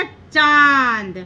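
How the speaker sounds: चांद with a long nasal vowel; drawn-out nasal pronunciation. Shown as a demonstration. Teacher showing children how to say चांद, long and nasal. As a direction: stretch चांद slowly, humming the vowel through the nose. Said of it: "कैसे हमने उच्चारण किया".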